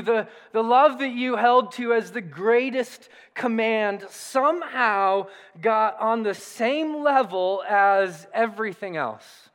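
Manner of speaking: normal talking voice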